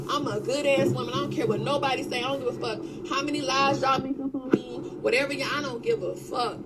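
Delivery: ordinary talk, nothing marked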